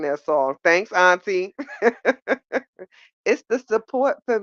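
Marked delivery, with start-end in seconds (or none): 1.6-2.8 laugh. Real, not acted. Reacting to chat support.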